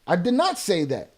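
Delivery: ordinary delivery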